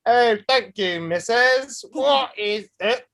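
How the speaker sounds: playful Northern English demo; Northern English accent. Shown as a demonstration. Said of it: "it's more Northern England".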